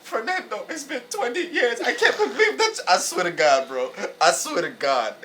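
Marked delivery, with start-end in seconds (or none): 0.0-2.8 put-on high, rising excited old lady. Grandma hearing from her long-lost grandson, high and squealing. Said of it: "This lady said".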